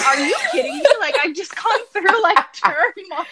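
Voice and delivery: high-pitched disbelief breaking into laughter; exasperated, comic retelling; incredulous outrage. Retold or imitated. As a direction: laugh through the protest, voice climbing. Retold outraged reply to doctor.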